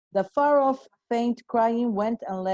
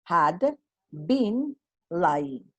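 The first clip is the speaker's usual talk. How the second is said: slow teacher emphasis; 3 semitones lower, much slower than usual. Shown as a demonstration. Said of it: "verb phrase".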